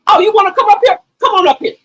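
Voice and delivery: loud, high mocking dare; imitating Mel. Streamer voicing Mel daring chatters, loud taunting. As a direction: taunt them to come up, loudly. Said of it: "And she said".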